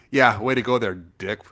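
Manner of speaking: sarcastic mock praise; extreme sarcasm. Cursing at another driver, extremely sarcastic. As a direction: jeer at a bad driver with fake approval. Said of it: "extreme sarcasm".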